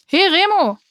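loud call from behind; lauter Ruf. A narrator's reading. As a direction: call out Remo's name sharply. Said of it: "rief plötzlich eine Stimme". (lauter Zuruf von hinten)

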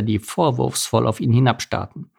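ordinary delivery

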